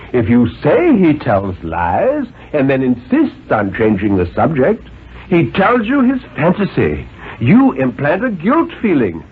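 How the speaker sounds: a lightly voiced stern, reasoning father; a hint of a condescending father; father's voice. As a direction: coax the boy like a patient but superior dad. Father lectures on fantasy.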